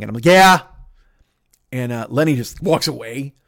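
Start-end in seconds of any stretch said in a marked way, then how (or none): 0.2-0.6 one loud, higher burst; retold defiant yell. Answering the woman who said stop yelling, defiant yell. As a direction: yell it straight back. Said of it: "you should probably stop yelling".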